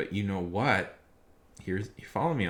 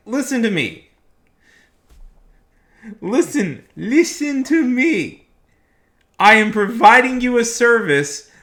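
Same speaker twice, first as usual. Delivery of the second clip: loud, much higher, hammering rant; heated rant; 11 semitones higher, 13 dB louder than usual. Real, not acted. Streamer ranting at customers who won't pay, loud heated rant. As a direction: lay into them, voice raised all through.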